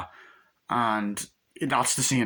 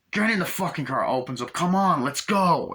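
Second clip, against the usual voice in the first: raised mock-kidnapper command; angry order; 7 semitones higher, 4 dB louder than usual. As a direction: order them in, pitched well up.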